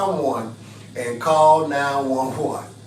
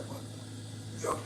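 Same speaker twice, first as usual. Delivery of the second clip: confused man voice; 17 dB quieter than usual. Retold or imitated. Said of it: "He gonna be confused, like".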